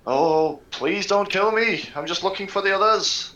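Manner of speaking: high-pitched, cowering beg; grovelling plea. Acted. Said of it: "use my grovel, cower, and beg".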